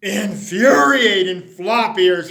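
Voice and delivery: slow angry burst at the bunnies; flaring anger. Acted. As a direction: drag out each word in fury. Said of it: "flared Mister McGreely".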